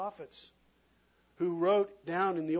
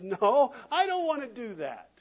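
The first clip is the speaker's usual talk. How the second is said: defiant refusal; 6 semitones higher, 5 dB louder than usual. Retold or imitated. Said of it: "each of us says".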